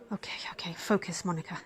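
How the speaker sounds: soft whisper, steadying herself; whispered self-talk. Acted. Nurse reminding herself to focus, whispered. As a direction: whisper it to pull yourself together.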